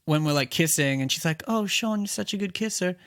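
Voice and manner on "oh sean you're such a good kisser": put-on girly voice. Retelling girl's line, high voice.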